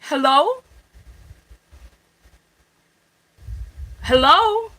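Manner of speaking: calling hello into a dead line; loud hello. Real, not acted. Caller checks dead phone line.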